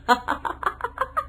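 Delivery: high breathless giggle. Acted. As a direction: giggle rises. (ऊँची खिलखिलाहट)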